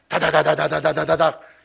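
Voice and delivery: imitated nonstop chatter noise; rapid-fire "dadada". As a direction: rattle out syllables like a machine gun. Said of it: "쉬지 않고 지금 저처럼". (쉬지 않고 쏟아내는 다다다 소리)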